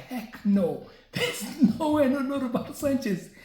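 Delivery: high laughing disbelief; laughing denial. Retold or imitated. Denying he knows Roberto Sanchez, laughing high. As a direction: laugh it off as absurd. Denies knowing the suspect.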